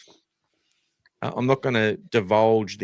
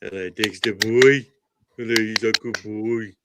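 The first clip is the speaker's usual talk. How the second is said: sweet doggy voice; talking sweetly to his dog; 4 dB louder than usual. Real, not acted. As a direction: coo at the dog like a pet owner.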